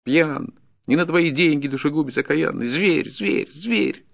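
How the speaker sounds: high-pitched furious accusation; enraged retort. Acted. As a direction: build to a high cry of abuse. Peasant retorts in rage.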